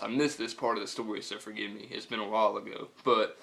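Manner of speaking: usual speaking voice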